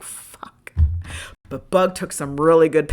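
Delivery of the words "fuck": voiceless dismayed whisper; whispered curse. Real, not acted. Finding the dent on the car, whispered dismay. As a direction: breathe the curse out. Discovering dent days later.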